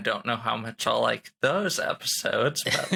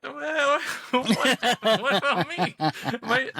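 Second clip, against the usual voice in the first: high, laughing what about me; laughing protest; 11 semitones higher than usual. Real, not acted. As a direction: squeak it out through a laugh.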